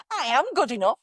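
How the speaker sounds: high, swooping mock affirmation; trying out inflections. Shown as a demonstration. Coach imitates clients testing different inflections, high and lilting. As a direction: try it on with an exaggerated lilt. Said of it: "going through all the different inflections of".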